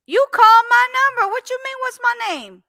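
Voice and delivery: very high, loud indignant retort; shrill mock outrage at a caller; exasperated outrage. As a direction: squeal it in disbelief. Retold indignant answer.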